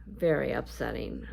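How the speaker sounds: normal talking voice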